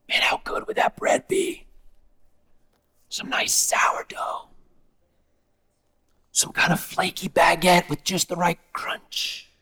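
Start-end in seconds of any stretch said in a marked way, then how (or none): 0.1-8.4 dreamy unvoiced whisper about bread; craving whisper. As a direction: whisper it like you can almost taste it.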